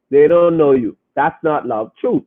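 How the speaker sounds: ordinary delivery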